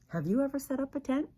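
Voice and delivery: plain reading voice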